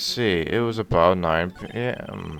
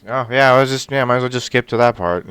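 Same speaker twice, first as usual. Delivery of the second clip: loud excited; 4 semitones higher, 11 dB louder, faster than usual. Real, not acted.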